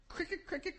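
high, soft cricket chirp; cricket imitation. Filling an awkward moment, cricket imitation.

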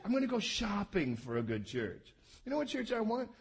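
ordinary delivery

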